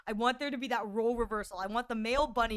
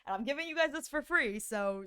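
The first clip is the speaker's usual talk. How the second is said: normal talking voice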